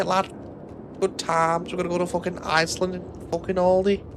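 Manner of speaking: high-pitched, hammed-up Scouse accent; Scouse impression. Streamer mocks how Liverpool lads talk, high Scouse impression. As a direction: imitate a Liverpool lad, pitched well up. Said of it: "That's how they all sound".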